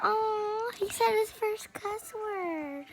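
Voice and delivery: high, soft doting parent tease; mock cooing. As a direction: coo as if proud of a baby.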